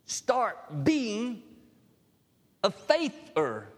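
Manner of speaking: Jesus commanding Thomas to have faith. Retold or imitated. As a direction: issue it as a command, word by word. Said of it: "Jesus in the name says".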